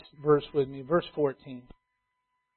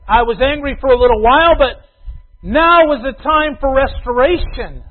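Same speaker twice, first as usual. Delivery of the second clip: louder, higher declaration; preaching; 8 semitones higher, 11 dB louder than usual. Acted. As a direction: proclaim God's words. Voicing God's words loudly.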